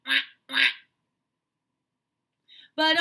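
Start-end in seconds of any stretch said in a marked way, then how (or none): 0.0-0.7 big quacking duck voice; loud duck quacks. Retold or imitated. Teacher voicing mother duck for the children, loud quacks. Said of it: "Mother duck said".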